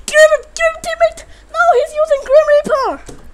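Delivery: high, loud frantic shouting; screeching for the teammate to kill him; panicked yell. Real, not acted. Panicked yelling at teammate mid-fight.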